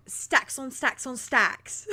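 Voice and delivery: put-on slow drawled character accent; character's accent. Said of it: "You say it in her accent so good".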